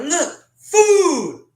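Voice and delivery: very high, loud, laughing cry of joy; excited shout. Retold or imitated. Starving kid shows his sister the macaroni box, laughing excited shout. Finds food after week starving.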